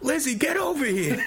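high squealing Brooklyn yell; a tiny tongue yells for the wife in a high Brooklyn squeal. Retold or imitated. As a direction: shriek for Lizzie in a put-on Brooklyn accent. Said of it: "this obvious, like, Brooklyn accent".

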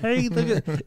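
high, froggy Muppet voice; Kermit-like voice. Pretend. Joking that the Kermit voice is trying to come out, high and froggy. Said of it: "You trying to come out?".

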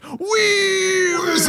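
high anguished shout; Platoon death scream. Retold or imitated. Retelling the Platoon death scene, anguished high shout. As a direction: scream it to the sky like a dying soldier. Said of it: "he's like".

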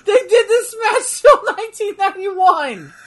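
high, laughing and incredulous; laughing disbelief. Real, not acted.

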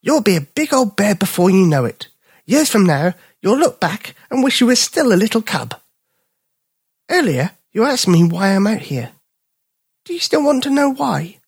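high, kindly dog voice; wise little dog. Acted. Little dog advising Bipper, high kindly voice. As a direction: advise the cub like an elder. Story figure, old dog.

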